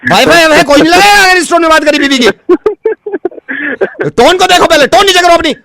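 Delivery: very high, insistent ranting; hammed-up outrage. Technician forbids wife's tone to customer, outraged rant. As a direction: lay down the law in mock fury.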